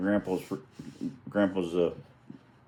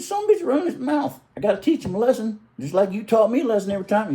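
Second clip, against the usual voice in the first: laughing retort; 13 semitones higher, 4 dB louder than usual. Retold or imitated. Recounting reply to uncle, laughing.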